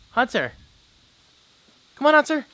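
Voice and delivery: loud high call across the yard; calling the dog. Real, not acted. Calling the dog Hunter in from the yard, high and loud. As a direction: shout for the dog to come back.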